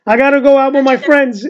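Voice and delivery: high Italian American son voice; Italian American accent. Retold or imitated. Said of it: "in this accent".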